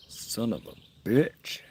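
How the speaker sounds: slow, high mock curse; milk the curse; drawn-out mock curse. Joking about his dead pacemaker, slow mock curse.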